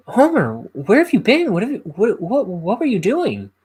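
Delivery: high, loud nagging cartoon wife; Marge. Wife demands where Homer has been, high-pitched cartoon scolding.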